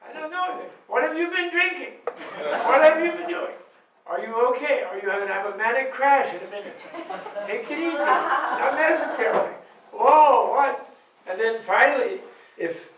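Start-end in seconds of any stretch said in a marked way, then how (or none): 0.1-10.9 high, incredulous questioning; suspicious disbelief. Retold or imitated. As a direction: grill them like something's off. Suspicious reaction to happy partner.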